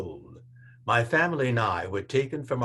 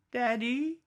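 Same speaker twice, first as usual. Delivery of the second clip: put-on much higher, unsure child's call; high, timid little boy; 12 semitones higher than usual. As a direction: call for daddy, small and uncertain. Child voiced calling father.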